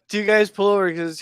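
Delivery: ordinary talk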